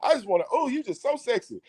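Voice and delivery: high, swooning come-on; mock flirty sender. People sending him naked pictures, high mock flirting. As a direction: coo it high, mocking the flirts.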